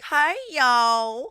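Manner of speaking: high, loud, stretched Southern "hey, y'all"; exaggerated Southern greeting. Teasing friend for representing their state, loud Southern drawl. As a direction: holler it with a hammed-up Southern twang. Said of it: "everyone's like".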